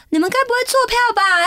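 high-pitched mock suspicion; suspicious call-out. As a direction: call it out high, as if catching them cheating. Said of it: "我心想说，我就说".

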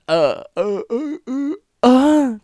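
呃 as a drawn-out schwa, climbing high and loud; exaggerated schwa. Shown as a demonstration. Showing viewers the unstressed vowel, 呃 as a comically exaggerated schwa. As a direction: repeat 呃 as a silly, stretched "uh". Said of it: "不要再用怪腔怪调".

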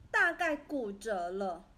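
plain reading voice

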